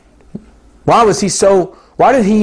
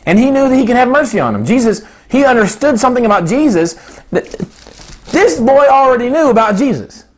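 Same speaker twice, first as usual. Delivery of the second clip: normal talking voice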